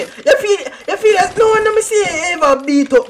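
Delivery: vexed shouting. Real, not acted. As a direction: yell it out, really vexed. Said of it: "me just shouting 'cause I'm vex".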